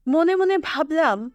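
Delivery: plain reading voice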